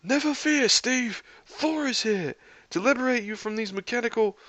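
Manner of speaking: high-pitched grand proclamation; heroic rescuer. A narrator's reading. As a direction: call out a rescue like a pompous hero. Thor announces a rescue, interrupted.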